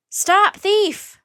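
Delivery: high loud yell. A narrator's reading. Two boys yelling after Jack in a chase. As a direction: shout down the street. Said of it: "both boys were yelling now".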